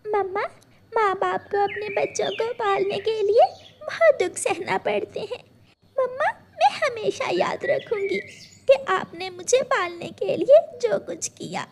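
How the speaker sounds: put-on tearful little girl. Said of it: "गुड़िया रोने लग जाती है".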